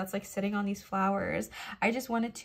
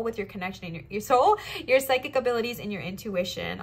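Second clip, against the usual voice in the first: ordinary delivery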